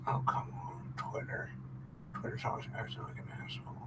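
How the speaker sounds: talking around a pen in the mouth; pen in teeth. Real, not acted. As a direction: mumble with a pen held in teeth. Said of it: "Got my pen in my mouth".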